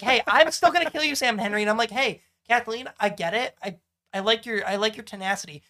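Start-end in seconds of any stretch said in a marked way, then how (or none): 0.0-1.6 raised mock threat; petty villain taunt. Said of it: "is a petty bitch and is like".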